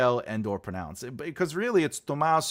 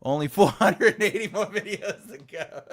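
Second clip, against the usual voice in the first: laughing through the words; 6 semitones higher, 3 dB louder than usual. Real, not acted. Joking with viewers about hundreds of videos left, laughing. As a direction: chuckle while saying it.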